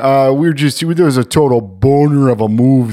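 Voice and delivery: put-on sorry-officer Canadian accent, apologetic. To the cop who pulled them over, hammed-up Canadian accent. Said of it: "The Canadian accent just came out".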